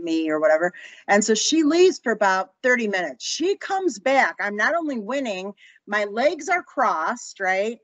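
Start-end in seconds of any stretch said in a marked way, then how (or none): none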